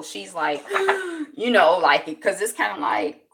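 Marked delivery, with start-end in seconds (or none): 0.7-1.3 sharp high gasp; imitated gasp of someone under stress. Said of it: "you can tell her stress level".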